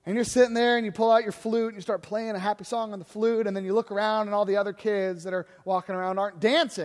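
normal talking voice

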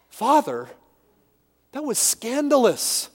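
ordinary talk, nothing marked

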